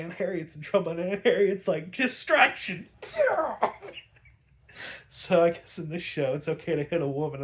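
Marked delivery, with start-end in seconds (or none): 1.9-2.9 high, slow, louder shout; mock battle cry. Aunt Harriet cries out as she smashes the vase, a loud high shout. 2.9-4.0 sudden squeaky laugh, very high; high burst of laughter. Real, not acted. Reviewer laughs at the fight, a high squeal. After retelling the vase hit.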